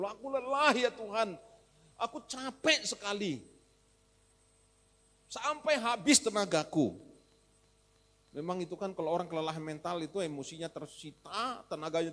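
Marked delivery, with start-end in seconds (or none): 0.0-7.0 higher, louder fed-up complaint; grumbling. Acted. Preacher acting Agur grumbling at God, fed up. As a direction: complain bitterly to God, really nagging. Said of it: "tapi ngomel betul".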